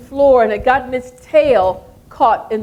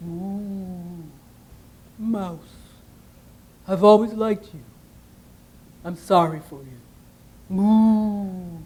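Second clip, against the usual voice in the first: gentle cow; 2 semitones lower, slower than usual. Acted. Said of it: "the cow said".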